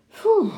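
high, loud blown-out "phew"; relieved "phew". A narrator's reading. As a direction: let out a sharp puff of relief. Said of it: "hij lachte opgelucht".

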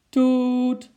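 long, high, loud horn toot; toeter nadoen. A narrator's reading. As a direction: imitate a car horn, sustained and high. Toeter klinkt uit de garage. (hoge, luide toeternabootsing)

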